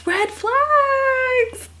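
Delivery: very high, crying squeal; shrieking disbelief. Real, not acted. Vlogger wailing at the red flags, squealing high. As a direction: wail it like you can't take it. Mock horror at romance.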